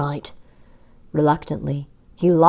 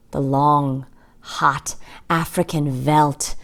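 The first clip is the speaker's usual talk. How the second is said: ordinary delivery, nothing marked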